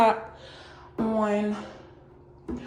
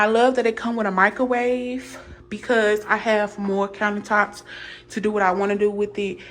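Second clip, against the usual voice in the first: normal talking voice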